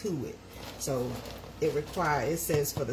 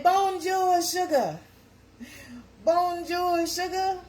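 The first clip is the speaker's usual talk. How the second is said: cheery French greeting; 11 semitones higher, 5 dB louder, slower than usual. Shown as a demonstration.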